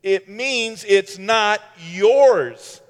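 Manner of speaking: ordinary delivery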